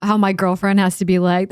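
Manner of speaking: ordinary delivery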